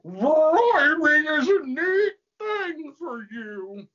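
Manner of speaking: high, loud, slow monster wail; over-the-top wailing demon; creature voice. Acted. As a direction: belt it out in a drawn-out shriek. Demon answers Satan in story.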